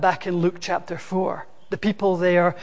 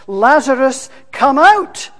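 shouted, much higher call into the tomb; loud call; 5 semitones higher, 8 dB louder than usual. Acted. Jesus calling Lazarus from outside the tomb, loud shout. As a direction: call him out of the tomb at full voice. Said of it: "called in a loud voice".

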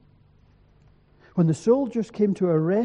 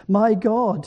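mild anguished howl, raised and louder; narrated howl, more lifted voice than full howl; howled plea; 4 semitones higher, 6 dB louder than usual. A narrator's reading. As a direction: cry out to God, higher and louder. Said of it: "he howled again".

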